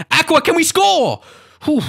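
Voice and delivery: high, loud yell at a scoring chance; excited. Real, not acted. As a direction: cry out as the chance opens up. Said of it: "getting excited".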